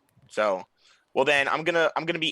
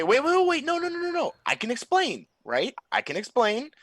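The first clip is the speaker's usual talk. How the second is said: much higher panicked pleading; 13 semitones higher than usual. Acted. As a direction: beg him to stop before the sword lands. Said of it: "I say".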